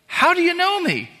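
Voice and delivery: high, astonished voice for Nathanael; amazed question. Acted. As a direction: ask it in sudden disbelief.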